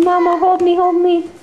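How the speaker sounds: voicing the baby. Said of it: "He's saying".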